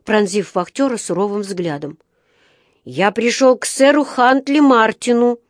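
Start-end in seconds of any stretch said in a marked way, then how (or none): 2.8-5.4 mildly indignant, higher and louder; возмущённо. A narrator's reading. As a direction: insist indignantly as a small bear. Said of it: "возмутился Паддингтон". (с лёгким возмущением, повыше)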